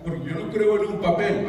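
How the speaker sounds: mildly mock a dismissive objection; mock protest. Mimicking couples who won't marry, mock protest.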